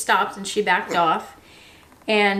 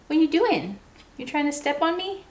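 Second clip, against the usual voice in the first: much higher, sing-song pet voice for the parrot; pet talk; 7 semitones higher than usual. Real, not acted. Cooing at her parrot.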